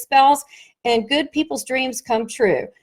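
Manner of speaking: usual speaking voice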